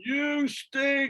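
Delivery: high, yelled taunt from the outfield; hammed-up high heckling; mock heckle. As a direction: jeer it like a fan banging drums. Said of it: "pounding drums saying".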